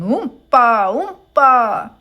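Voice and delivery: loud cheerful "Oompa!" chant; shouting. A narrator's reading. Little dancing men shouting as they play accordions, loud. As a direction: belt it like a festive band shout. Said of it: "playing accordions and shouting".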